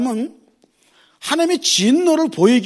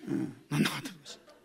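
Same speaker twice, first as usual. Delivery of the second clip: soft chuckling echo of 난 나갔다 들어왔으니까; laughing aside; 7 semitones lower, 12 dB quieter than usual. Retold or imitated. Pastor echoes the child's excuse to the congregation, softly laughing. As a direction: repeat it quietly with a laugh.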